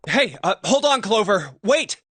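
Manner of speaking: calling after. Acted. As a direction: shout after her to stop. Calling after Clover running off.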